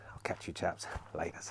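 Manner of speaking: soft breathy whisper goodbye; whispered sign-off. Real, not acted. Signing off to the viewers before cleaning, in a whisper.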